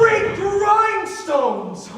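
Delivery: loud, very slow burst of amazement; amazed exclamation. Acted. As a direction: exclaim in wonder.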